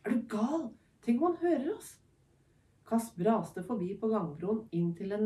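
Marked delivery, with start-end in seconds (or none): 0.0-2.0 high, urgent alarmed hiss-free plea; rising panic, pitch climbing high. Acted. Tycho scolds his sister for calling out, high alarmed voice.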